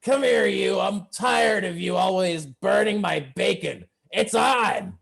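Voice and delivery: high, shouted halfling challenge; angry halfling. Acted. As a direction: pick a fight at the top of a small voice. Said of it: "He's like".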